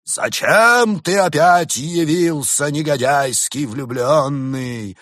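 high, loud, slow scolding; angry father. A narrator's reading. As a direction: drag out each furious word. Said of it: "рассердился он". (сердитый отец, громко и протяжно)

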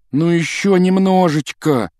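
high, drawn-out pleading. A narrator's reading. Ron begs the failing car to keep going, pleading. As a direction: beg it along, stretching the words slowly. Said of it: "умолял её Рон".

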